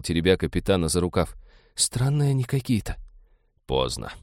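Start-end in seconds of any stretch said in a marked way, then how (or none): 1.7-3.0 high, timid complaint; plaintive worry. A narrator's reading. Jill confiding in her captain about the strangers, plaintive. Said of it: "жалобно прошептала Джилл". 3.7-4.1 low, soft note of annoyance; vexed reply. A narrator's reading. Roger answering Jill that escape is impossible, vexed. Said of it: "с досадой отозвался Роджер".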